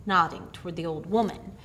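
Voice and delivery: plain reading voice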